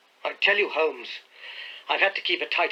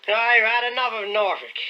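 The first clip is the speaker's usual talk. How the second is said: put-on thick, slurred drunk voice, higher and louder; boozy insolent butler; half-drunken man; 9 semitones higher, 6 dB louder than usual. A narrator's reading. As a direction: sneer it out like a drunk servant. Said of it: "in the thick voice of a half-drunken man".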